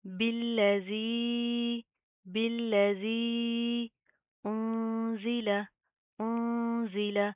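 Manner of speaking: very slow, stretched vowels; tajweed recitation. Shown as a demonstration. Teacher reciting the words slowly with tajweed. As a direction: draw out each vowel in recitation. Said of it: "इसको लंबा करेंगे, खींच कर पढ़ेंगे".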